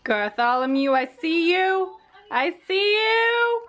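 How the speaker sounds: playful singsong call. Pretend. Vlogger teasingly calls out to Bartholomew, slow rising singsong. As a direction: stretch the words into a slow, rising mock singsong.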